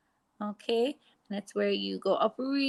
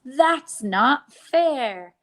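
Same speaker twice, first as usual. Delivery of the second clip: put-on "That's not fair." slow and louder, a child's sulky protest; mock complaint; 4 semitones higher, 8 dB louder, much slower than usual. Shown as a demonstration. Teacher acting children denied treats, sulky and loud. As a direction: whine it like a left-out child. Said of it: "the sentence we say is".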